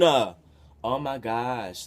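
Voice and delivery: long, loud, drawn-out oh my gosh; stunned groan of disbelief; exasperated disbelief. Real, not acted. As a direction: let it sag out slowly in disbelief. Disbelief at own story.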